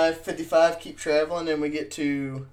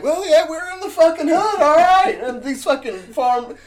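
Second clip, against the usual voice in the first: much higher and louder, yelling the obvious; exasperated shout; 13 semitones higher, 8 dB louder than usual. Retold or imitated. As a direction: blurt it out like it's plain as day. Retorting to nervous friend.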